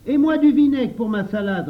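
high, loud clamouring; loud demand. A narrator's reading. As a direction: shout your claim right after the others. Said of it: "réclama Henri".

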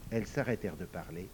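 ordinary delivery, nothing marked

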